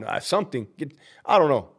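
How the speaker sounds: ordinary talk, nothing marked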